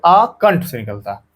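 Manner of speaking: normal talking voice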